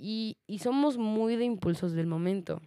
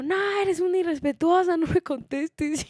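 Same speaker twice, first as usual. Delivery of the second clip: high put-on scolding, slowing down; mock indignant lady; 11 semitones higher, 4 dB louder than usual. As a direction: imitate the offended woman telling her off. Lady scolding her as disrespectful.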